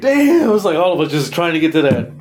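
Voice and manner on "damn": high, loud burst of amazement; high startled damn; amazed exclamation. Retold or imitated. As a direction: shout it out like you can't believe the surge. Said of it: "and I'm all like".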